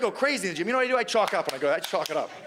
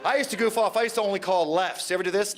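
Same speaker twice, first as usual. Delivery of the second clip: ordinary talk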